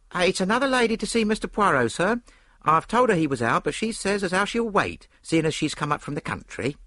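high, loud landlady voice; chatty landlady. A narrator's reading. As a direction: announce a visitor like a busy landlady. Story figure, landlady announcing visitor.

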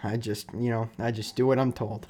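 low, soft, resigned-sounding answer; understated mimicked shrug of a reply; imitated coach's deadpan reply. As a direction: drop it low and quiet as the coach. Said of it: "And he just goes".

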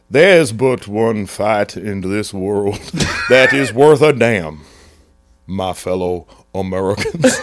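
put-on slow, low oratory in a muddled Scottish-Southern accent; stately Scotch-Southern speechifying, drawn out; mock Scotch-Florida American. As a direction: Churchill meets Southern preacher, in a mongrel accent. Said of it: "Scotch Florida American".

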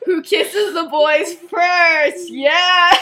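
loud, laughing mock cheer; excited cheer. Effie cheering Sirius's kissing story, loud laughing cheer. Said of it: "and Effie's like".

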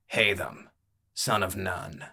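understated, falling statement of a name; terse fighter. Acted. Fighter giving his name to the barkeep, tersely. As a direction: give the name and leave it there.

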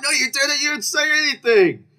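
very high mock-outraged yell; exasperated contradiction. Diner contradicts friend's excuse, high exasperated protest. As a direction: argue back at a squealing pitch. Acted quarrel over mistaken friend.

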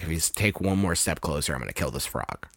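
low, soft villain threat; menacing threat. Retold or imitated. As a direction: growl it quietly as a cornered mobster. Villain's threat in retold movie.

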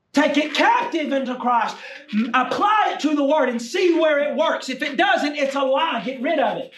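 high, emphatic preacher's delivery; forceful preaching. Acted.

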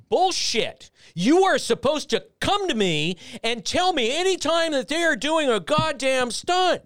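imitating the boss chewing someone out; mock angry boss. General manager berating Carl in the meeting, high mock rant.

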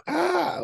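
a sudden high, loud mock yelp; yelp of pain. A weak dog showing pain, a high mock yelp. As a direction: yelp like a dog that feels the hurt. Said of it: "doesn't just go".

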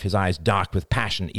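usual speaking voice

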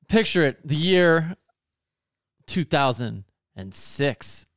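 slow, hushed storyteller's build-up; mock-dramatic flashback. Hamming up a flashback for the podcast host, slow and hushed. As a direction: set the scene like a grand old tale.

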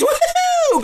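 very high whoop of joy; whooping cheer. Retold or imitated. Hunter celebrating a great hunt with a very high whoop. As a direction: let out a big victory whoop.

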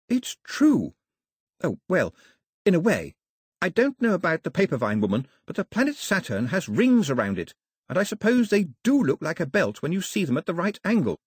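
a hint of an eager young girl; earnest girl, lightly voiced. Daphne explaining Saturn's rings.

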